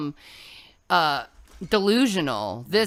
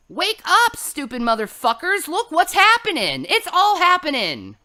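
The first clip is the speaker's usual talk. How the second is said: much higher, louder shout; yelling wake-up call; 8 semitones higher, 5 dB louder than usual. Real, not acted. Preacher shouting at her audience to wake up, loud high yell. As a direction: bellow it to jolt them awake.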